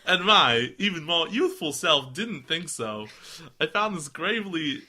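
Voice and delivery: theatrical, swooping American reading; hammed-up American storyteller; exaggerated American accent with stretched words; mock American accent. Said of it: "going back to Southern".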